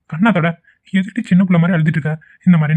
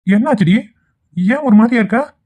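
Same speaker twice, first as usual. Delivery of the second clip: concerned questioning; 6 semitones higher than usual. Acted. Husband finds his wife upset.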